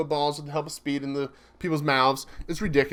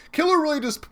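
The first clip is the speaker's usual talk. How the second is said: excited; 12 semitones higher than usual. Real, not acted. Excited reaction, sudden high pitch.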